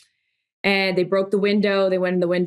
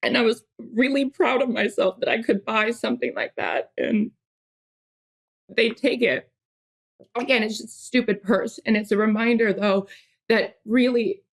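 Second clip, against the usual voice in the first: fighting back tears over the stolen bag; a real touch of tearfulness in the voice; getting emotional; 4 semitones higher than usual. Real, not acted. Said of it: "I don't know why I'm getting emotional about this".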